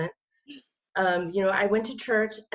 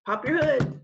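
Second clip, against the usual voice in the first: imitating the mechanic's order; 8 semitones higher, 6 dB louder than usual. Stranger mechanic ordering, retold.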